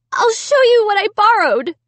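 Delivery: high, loud desperate cry. A narrator's reading. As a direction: insist desperately. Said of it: "I cried".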